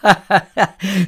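high, loud acted laugh; a short hearty character laugh. A narrator's reading. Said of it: "Frau Radek lachte herzlich".

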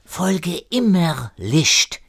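put-on slow, high French-accented German. René answering Erik's question, slow French accent. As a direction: intone it slowly with a French accent. René's accented instruction to Erik.

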